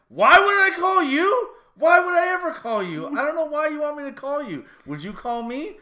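loud, high, incredulous shouting at the wife; exasperated yell. Retold or imitated. Retelling a fight with his wife, shouting in disbelief. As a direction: yell it back at her like it's absurd.

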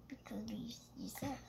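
hushed whisper aside. Real, not acted. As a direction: whisper it secretly.

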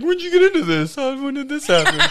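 high, bright friendly chatter with a laugh; suddenly all nice. Retold or imitated. Drunk guy turning friendly with the cop, high and laughing. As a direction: switch to gushing buddy mode. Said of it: "Then I started getting all nice".

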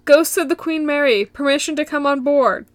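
a hammed-up call into the dark ship; mock yell. Zach calling out to the ghosts as he enters, mock yell. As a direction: announce it to the ghosts, half-shouting. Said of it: "Zach yells".

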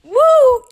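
loud high-pitched whoop; excited whoop. Real, not acted. Listener cheering her sister's cussing story, delighted whoop.

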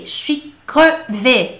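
slow, careful je suis crevé; slow model phrase. Shown as a demonstration. Teacher modeling French slang slowly for students, slow. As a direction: stretch crevé out for learners.

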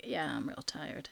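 low, soft, slow sleepy mumble-along; Nathaniel, worn out. Retold or imitated. Joking about a canned soundbite of the sleepy co-host, tired imitation. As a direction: impersonate a tired co-host, dragging and quiet. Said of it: "have a little soundbite of Nathaniel".

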